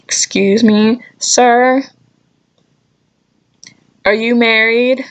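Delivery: ordinary delivery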